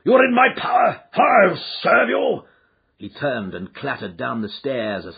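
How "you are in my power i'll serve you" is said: furious shouting, much higher, louder, in a changed voice; the fat man raging, shouting threats; raging shriek. Acted. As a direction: rage at the thieves, slowly. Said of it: "he shrieked".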